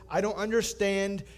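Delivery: castaway baffled, still panicking; dumbfounded panic. Acted. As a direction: shout in disbelief. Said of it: "still dumbfounded".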